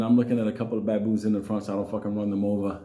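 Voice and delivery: ordinary delivery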